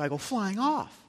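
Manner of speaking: imitating daughter. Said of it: "And she go".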